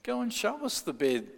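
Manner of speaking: high, mocking challenge; doubting flock. His doubting congregation challenging Yonggi, high mock voice. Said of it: "So they said".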